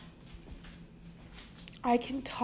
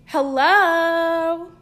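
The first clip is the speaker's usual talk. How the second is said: calling out; 6 semitones higher, 7 dB louder than usual. Pretend. Calling to hidden devices.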